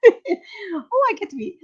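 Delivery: high, hammed-up giddy voice; mock eagerness. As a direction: play yourself as eager and silly. Mock eager self-parody.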